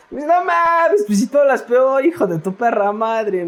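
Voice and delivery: incredulous rough friend. Retold or imitated. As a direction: shout it back like a foul-mouthed buddy who can't believe it.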